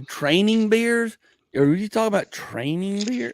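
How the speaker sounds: high, drawn-out questioning; puzzled wife. Retold or imitated. Wife asking comedian about his opener, puzzled and high. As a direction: imitate a baffled wife, voice lifted.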